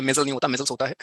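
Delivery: मेजल without s, then मेजल्स with a clear final s; plain मेजल set against मेजल्स; final s contrast. Shown as a demonstration. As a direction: add the s sound in मेजल्स. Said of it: "जो हम गलतियां करते हैं".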